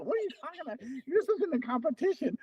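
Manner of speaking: high, soft retold objection; mock disbelief, pitched well up; incredulous protest. Athlete objects to teammate at the parade, incredulous retelling.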